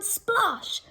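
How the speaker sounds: high splashing splosh. Acted. Thieves stepping into the pond, a high splosh. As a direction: make the splash sound.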